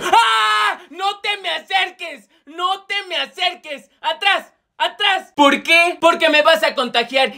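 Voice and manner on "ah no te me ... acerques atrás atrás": high frantic yell to keep him away; panicked shout. Acted. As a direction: scream like he's contagious. Profe asustado por la varicela. (el profe grita asustado para que no se acerque)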